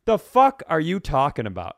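ordinary talk

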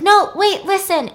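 high and loud, suddenly pleading; urgent high plea. Retold or imitated. The avenger urgently stopping her boyfriend, high loud plea. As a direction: stop him, urgently. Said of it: "And she's like".

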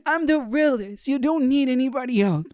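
high mock whine of a needy lover; mock crying boyfriend. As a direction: imitate a clingy boyfriend begging his man. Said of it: "crying to his top".

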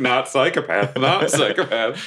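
comic despair, repeated to yourself; head-in-hands dismay. Acted. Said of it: "I'm just holding my head".

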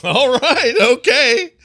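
high, loud "all right, okay" through a laugh; shrugging off the guest's answer with a laugh; laughing resignation. Retold or imitated. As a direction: laugh it off and let it drop. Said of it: "sit back and grin".

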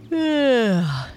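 high sleepy yawned ugh; yawning groan. Acted. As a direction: yawn the ugh out. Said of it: "yawned Otto".

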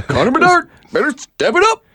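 high, laughing through it; Devin Peacock impression. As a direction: imitate with a laugh breaking in. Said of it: "That's my Devin Peacock impression".

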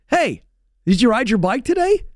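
high, bright questioning dad voice; dad home from work quizzing his kid, high and cheery. Retold or imitated. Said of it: "I say to my kid".

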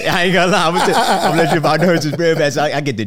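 laughing loudly while talking. Real, not acted. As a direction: laugh through the words. Nervous guest laughing at himself.

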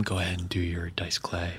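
soft unvoiced whisper. Real, not acted. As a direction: whisper the aside so mics miss it. Aside while recording.

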